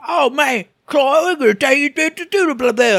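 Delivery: high, loud brash imitation; imitating Mid. Host mimics the annoying game character, high and brash. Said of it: "I hated Mid's voice".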